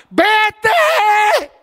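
booming high shout of God; God's command. Acted. God ordering the believer away, booming shout. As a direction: thunder the command from above.

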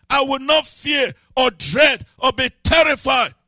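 preacher shouting a chain of refusals; shouted declaration. Real, not acted. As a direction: hammer each refusal of fear.

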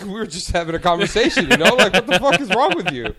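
high, very loud mock protest; retold indignant outburst. Bartender challenging a rude customer, retold indignant outburst. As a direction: re-enact yelling at him in disbelief.

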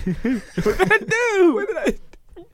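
hammed-up cry of innocence; mock protest.

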